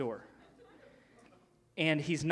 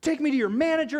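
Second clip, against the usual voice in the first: imitated irate customer demand; high, loud mock outrage; freaking out; 10 semitones higher, 8 dB louder, faster than usual. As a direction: demand it loudly, pitch jumping up. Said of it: "freaking out on this guy".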